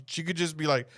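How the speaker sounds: ordinary talk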